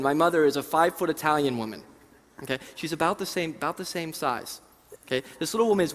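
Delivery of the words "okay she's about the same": ordinary delivery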